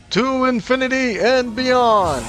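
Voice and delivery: high, slow heroic cartoon voice; mock space ranger, slow and high; Buzz Lightyear quote. Streamer hams up a heroic cry for chat, high and slow.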